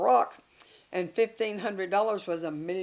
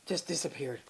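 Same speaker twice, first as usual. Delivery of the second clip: normal talking voice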